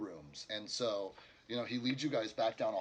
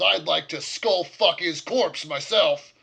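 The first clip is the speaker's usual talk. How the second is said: much louder, slow seething threat; angry orc; 6 semitones higher, 14 dB louder, slower than usual. Acted. Orc innkeeper vowing revenge on the dead leader, loud and slow. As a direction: relish the threat slowly. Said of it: "Jakah says".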